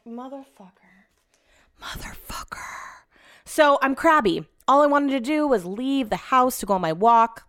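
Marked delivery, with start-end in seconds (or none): 0.0-2.6 slow, seething whisper; barely audible, drawn-out whispered swearing; whispered curse. Retold or imitated. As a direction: curse him under your breath. Cursing her husband under breath.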